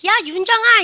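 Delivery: loud high-pitched shout to someone far off; calling out. Acted. Teacher spots a student walking away far off, calling out. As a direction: yell the name across a distance. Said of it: "이렇게 했는데 소리 질렀잖아요".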